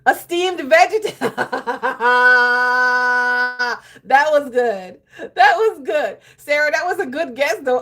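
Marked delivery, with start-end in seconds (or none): 1.2-4.0 real helpless laugh; loud burst of laughter. Real, not acted. Laughing at the punchline. 4.1-7.8 high, loud praise through laughter; real delighted laugh in the voice. Real, not acted. Laughing praise after joke.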